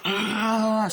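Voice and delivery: high, loud imitated roar; attacking yell. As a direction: lunge with a long roar. Imitates Chucky starting to attack.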